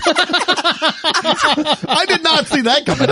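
slow, high, drawn-out astonishment; stunned disbelief. Real, not acted. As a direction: let each word hang in shock. Stunned reaction to cover news.

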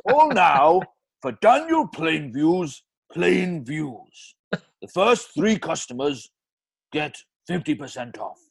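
slow Welsh advert tagline; Welsh accent. Acted. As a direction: sell it in Welsh. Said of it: "that Welsh accent".

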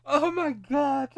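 slow, high, breaking into laughter; laughing. Real, not acted. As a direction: laugh through the words. Cracking up at the list.

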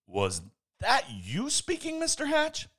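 rising high in disbelief; shocked. Acted. Newsman Mister Smith stunned that Hatch spoke. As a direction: ask as if you can't believe it. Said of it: "Mister Smith was shocked".

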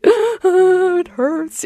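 put-on high, small, hurt child's voice; imitating a daughter whining it hurts; child's hurt whimper. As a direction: play the little girl with a skinned knee. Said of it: "she came to me and she's like".

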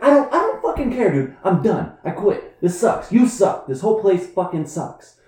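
high, loud hammed-up outburst; staged angry tirade. Employee pretending to quit, high angry rant. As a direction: rant in bursts for the audience.

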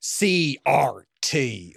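slow, scornful put-on drawl; mock sneering slur. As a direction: drag Cultural C R T out like a slur. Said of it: "like a slur with them".

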